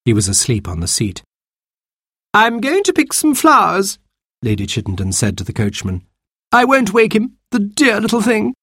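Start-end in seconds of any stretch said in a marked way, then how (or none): 2.3-4.0 much higher sweet-talking lady voice; Lady Chittenden cooing at the baby, much higher; grand lady gushing. Acted. Lady to coachman. 6.5-8.5 much higher sweet lady voice; Lady Chittenden cooing to the coachman; grand lady cooing. Acted. Lady speaks of baby.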